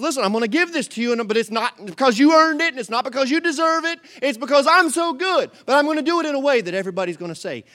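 loud, high, rushing divine promise; booming fast God voice; God's voice, fast and loud. Acted. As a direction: declare it as God, quick and bold. Preacher voicing God's promise.

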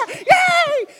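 loud, high mock cheer; mock cheering parent. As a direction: scream it like a proud parent in the stands. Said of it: "screaming for their kids".

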